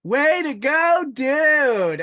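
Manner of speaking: high, slow, loud cheesy cheer; Melvin's line. Retold or imitated. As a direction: stretch it out like a goofy teen. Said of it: "the infamous line from Melvin".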